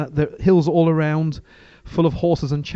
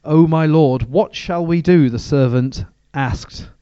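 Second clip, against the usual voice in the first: steady, louder reading of the Bible passage; scripture reading; 5 dB louder than usual. Acted. Preacher reads scripture aloud to the church, measured and steady. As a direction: read the verses out to the congregation with weight.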